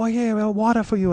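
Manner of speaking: high-pitched imitated woman's voice; mock-solicitous high voice; woman offering water. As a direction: play her helpful, pitched well up. Acts out the woman returning.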